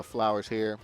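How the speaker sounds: ordinary delivery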